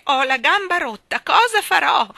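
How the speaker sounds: loud, high-pitched wailing lament; moaning. A narrator's reading. The maid bemoaning her leg, moaning. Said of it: "Ellen tornò a gemere".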